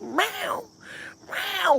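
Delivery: high, distressed cat meow; imitated crying meow. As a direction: mimic a stressed cat wailing. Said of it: "screaming, crying".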